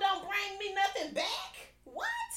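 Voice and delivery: ordinary delivery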